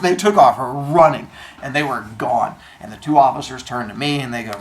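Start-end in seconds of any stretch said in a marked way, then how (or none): none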